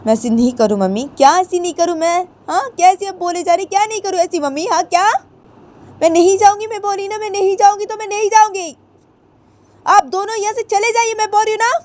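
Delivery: a daughter screaming she won't leave; defiant daughter yelling. Acted. Ritu refuses to leave with her parents, high angry yell. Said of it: "तो ऋतु बोलती".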